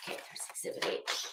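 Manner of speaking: unvoiced whisper of the find; hushed aside while finding the exhibit. Real, not acted. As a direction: breathe it out under your breath.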